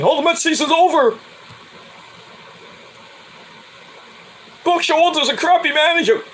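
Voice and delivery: high, mocking whine of a fake fan; mock the panicky fans; whiny fake fan. Vlogger mocking fake Met fans, high whine. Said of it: "people are complaining".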